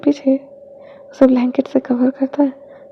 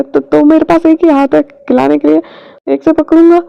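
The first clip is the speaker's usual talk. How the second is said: stammering, louder and higher; flustered excuse; 3 semitones higher, 6 dB louder than usual. Acted. Caught-out husband defending himself, flustered loud stammer. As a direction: blurt the excuse nervously. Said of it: "wing गल्प करता है".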